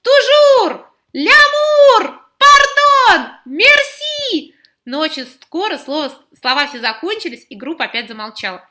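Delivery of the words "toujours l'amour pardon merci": very high, loud shouting; shouting out words. Acted. Pupils shout out French words they remember. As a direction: blurt out the words eagerly. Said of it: "стали выкрикивать французские слова".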